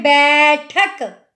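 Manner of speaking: बैठक said slowly with a long बै; lengthened ai. Shown as a demonstration. Teacher showing the long बै in बैठक. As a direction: hold the बै a little longer. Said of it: "थोड़ा सा हम उसको लंबा भी बोल रहे हैं".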